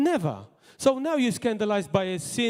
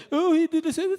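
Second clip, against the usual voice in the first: higher, louder mocking tone of a gossip; mock accusation; 11 semitones higher, 4 dB louder than usual. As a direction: sneer it like a self-righteous accuser. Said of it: "those who say that".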